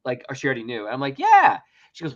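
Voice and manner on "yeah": high, loud eager yeah. Retold or imitated. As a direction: chirp it out. Eager answer to the ranger.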